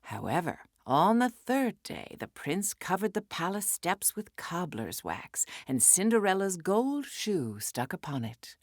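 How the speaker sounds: ordinary delivery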